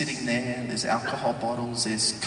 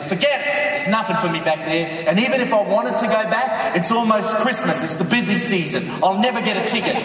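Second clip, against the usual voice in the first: loud, high-pitched objecting; defiant protest; 10 semitones higher, 8 dB louder than usual. Acted. Husband refusing his wife's plea to come home, loud protest. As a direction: argue back, piling up excuses.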